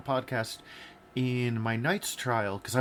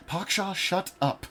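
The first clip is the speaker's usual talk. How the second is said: higher, slow mock snap at a friend; exasperated retort; 8 semitones higher, slower than usual. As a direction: drag it out, fed up but joking. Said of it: "And I was like".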